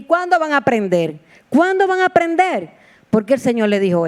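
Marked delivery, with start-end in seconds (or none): 1.5-2.7 exasperated rebuke. Acted. Jesus rebuking his disciples, high exasperated repeat.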